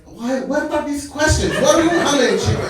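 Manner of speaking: put-on high, flustered Arabic-accented questioning; exasperated shopkeeper. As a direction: imitate a shopkeeper fed up with questions.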